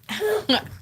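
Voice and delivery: high giggle. Real, not acted. Laughing at the sign-off.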